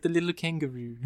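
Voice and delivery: a hint of cute French accent, softly; French chick impression. Fan quoting the French chick from Pulp Fiction, light French accent. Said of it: "the way she says that".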